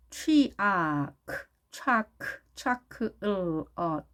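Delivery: "취아크" slowly split into ch, ah, k, then l, ut; sound-by-sound demo. Shown as a demonstration. Teacher assembling chocolate for learners, slow sound-by-sound "취아크". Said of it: "차 발음".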